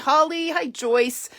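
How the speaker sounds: ordinary talk, nothing marked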